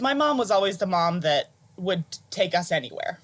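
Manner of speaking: ordinary talk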